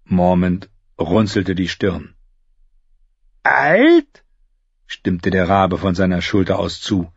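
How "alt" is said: high, squawking bird voice; raven voice. Acted. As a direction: croak it like a raven. Raven echoes the word.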